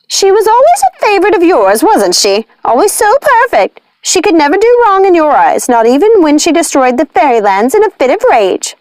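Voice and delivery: high, sneering witch voice; scoffing witch. A narrator's reading. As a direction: scoff at the old favourite. Martha scoffing at Nanny.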